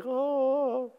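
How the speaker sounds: high, soft swooning "oh"; worshipful crowd. Retold or imitated. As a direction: imitate a congregation sighing in worship. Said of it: "And everybody's like".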